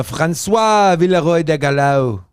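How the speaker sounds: names read in a hammed-up French accent. Host reading out a French official's name in a French accent. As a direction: give it your best French pronunciation. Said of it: "let me know if that was a good French accent".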